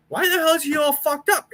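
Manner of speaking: shrill up in disbelief at it all; retold disbelief. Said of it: "And I'm like".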